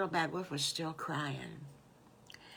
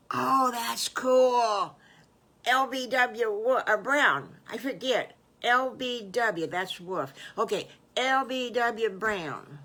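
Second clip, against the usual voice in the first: loud, stretched-out excitement; delighted child character; 3 semitones higher, 8 dB louder, slower than usual. Acted. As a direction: gush slowly over a cool new name. Wolf child delighted with new name.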